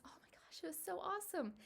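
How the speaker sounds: overcome, barely getting the words out; emotional whisper. Real, not acted. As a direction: voice drops to a choked whisper. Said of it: "now I'm getting emotional".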